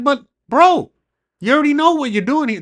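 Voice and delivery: high and loud, calling him out; retold exasperated protest. Driver calling out the deputy's motives, exasperated. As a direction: accuse him, fed up.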